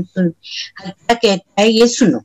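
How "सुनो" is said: ordinary delivery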